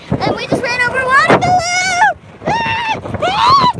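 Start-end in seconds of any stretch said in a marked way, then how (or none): 2.5-3.7 sudden high shriek of surprise; startled shriek. Real, not acted. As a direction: shriek at the pop. Water balloon burst under cart.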